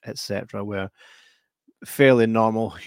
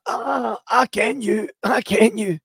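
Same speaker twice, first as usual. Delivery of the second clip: much higher, rising accusation; shot man recognizing; 11 semitones higher, 3 dB louder, slower than usual. Retold or imitated. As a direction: realize who it is and say so. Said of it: "he's like".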